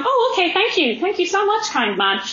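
high, gushing thanks; naive gratitude. Acted. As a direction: play a trusting woman thanking her manipulator. Voices the trusting, manipulated woman.

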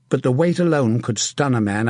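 ordinary delivery, nothing marked

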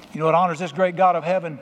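normal talking voice

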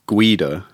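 "Guido" as GWEE-doh; "Guido", W sound after the G. Shown as a demonstration. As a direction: put a clear W in "Guido". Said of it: "with a W".

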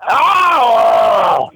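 high wailing zombie cry; zombie noise. Pretend. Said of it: "Oh, ten".

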